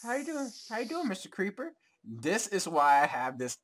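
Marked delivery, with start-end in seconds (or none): 0.0-1.7 sing-song high greeting; high cutesy voice. Pretend. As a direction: coo it up high like to a pet. Repeating cute greeting.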